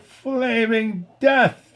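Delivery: very high, very slow showman cry; dramatic announcement. A narrator's reading. Circus manager announcing the fire act, hammed-up. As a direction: announce it like a desperate ringmaster. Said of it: "the desperate circus manager announced".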